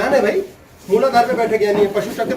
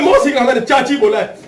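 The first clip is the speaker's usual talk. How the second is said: mock quarrel, laughing; 5 semitones higher, 4 dB louder than usual. Acted couple's quarrel.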